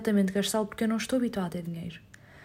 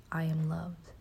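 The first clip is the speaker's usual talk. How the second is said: soft echo; 3 semitones lower, 7 dB quieter, much slower than usual. Shown as a demonstration. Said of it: "Say it with me".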